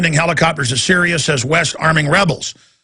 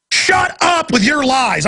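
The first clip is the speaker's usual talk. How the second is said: slow, higher, biting dismissal; angry rant; 10 semitones higher, slower than usual. Real, not acted. Ranting at the liars, slow angry higher voice. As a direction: spit out each word, slowly.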